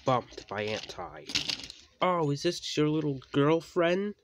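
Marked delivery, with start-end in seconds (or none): 2.0-4.1 higher, louder mocking tease; needling and loud; teasing. A narrator's reading. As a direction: taunt them in a raised, loud voice. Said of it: "teased Anti".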